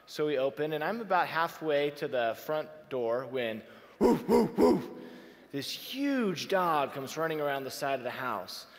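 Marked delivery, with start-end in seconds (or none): 4.0-4.8 loud barking woof, woof, woof; mock barking dog, loud and sudden; imitated dog bark. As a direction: bark like a big dog bursting out.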